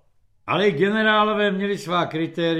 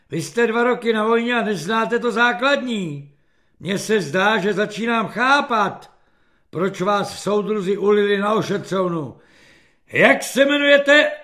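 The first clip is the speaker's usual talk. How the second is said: loud, raised officer's dressing-down; thundering reprimand; 5 semitones higher, 6 dB louder than usual. A narrator's reading. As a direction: scold the soldier, building to a shouted question. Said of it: "zařměl". (hřmotné velitelské kárání)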